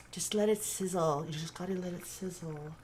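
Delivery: light imitation of a soft, breathy pop star. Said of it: "I don't know if he sounded exactly like that".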